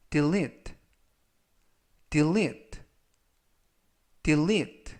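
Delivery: delete with the stress on the second e, said three times; model pronunciation. Shown as a demonstration. Teacher models delete for students, second syllable stressed. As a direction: model delete, leaning on -lete. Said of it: "라고 발음하시면 되겠고요".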